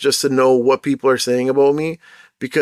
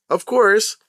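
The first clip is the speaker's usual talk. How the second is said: usual speaking voice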